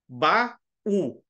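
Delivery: "Ba-u" as two detached beats; split into syllables. Shown as a demonstration. Teacher explaining a hiatus, "Ba-u" pronounced syllable by syllable. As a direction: separate the A from the U in "Ba-u". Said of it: "O A numa sílaba".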